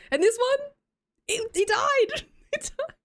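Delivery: high-pitched squeak, breaking into laughter; laughing squeal. Real, not acted. Streamer confessing to chat that the plant died, laughing squeal. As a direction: squeal it helplessly through laughter.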